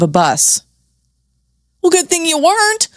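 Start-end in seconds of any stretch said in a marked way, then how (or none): 1.8-2.9 high, snide jab; sarcastic quip. Real, not acted. Mocking the memoir's line about trust, high and snide. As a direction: needle the author with a fake sweet tone.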